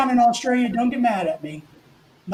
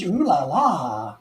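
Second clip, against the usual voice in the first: drawn-out mock French ooh la la; French accent; 6 semitones lower, much slower than usual. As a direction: put on a slow, swooning French accent. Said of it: "You go like some French".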